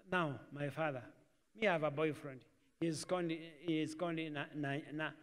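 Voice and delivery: soft, halting, stumbling over the name; acted reluctance, voice dropped small; timid confession. Daughter telling father about her boyfriend, timid and halting.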